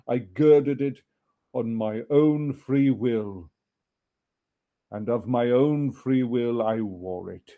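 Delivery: slow, solemn, loud ghost. Acted. Ghost telling Scrooge of his chain, slow and solemn. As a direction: weigh each word like chain links.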